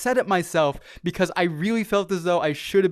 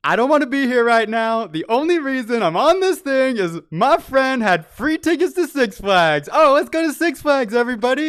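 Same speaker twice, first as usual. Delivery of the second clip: hammed-up griping, voice raised high; mock panicked rant, louder and higher; 7 semitones higher, 6 dB louder than usual. Climbing the coaster, ranting loudly at his friends in mock panic. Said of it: "I'm just sitting there going".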